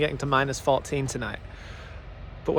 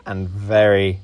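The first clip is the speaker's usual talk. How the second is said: lower, steady documentary-style narration; travel voiceover narration; 7 semitones lower than usual. A narrator's reading.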